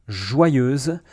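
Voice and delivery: joyeuse with a clear z at the end; model pronunciation. Shown as a demonstration. Teacher breaks down joyeuse for learners, clear model. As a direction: give joyeuse a buzzing z ending. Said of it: "First of all".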